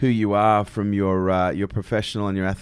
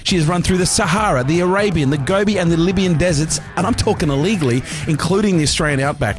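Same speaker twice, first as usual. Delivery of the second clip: punchy, lifted announcer delivery; podcast host introduction; 4 semitones higher, 6 dB louder than usual. Acted. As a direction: introduce the guest like a big event.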